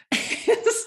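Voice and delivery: short high airy laugh; a quick fond laugh; breathy laugh. Real, not acted. Laughs at freckles memory.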